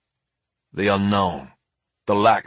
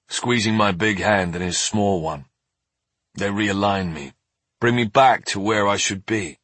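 ordinary delivery, nothing marked

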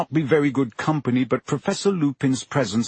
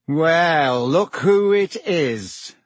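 slow, higher sneering drawl; lazy drawl; 4 semitones higher, 4 dB louder, much slower than usual. A narrator's reading. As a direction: drawl it out lazily. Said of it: "in his usual lazy drawl".

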